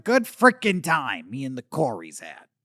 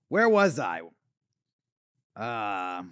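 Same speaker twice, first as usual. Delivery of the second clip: normal talking voice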